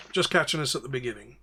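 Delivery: ordinary talk, nothing marked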